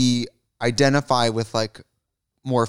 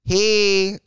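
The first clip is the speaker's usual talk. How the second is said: camp greeting; 8 semitones higher than usual. Pretend.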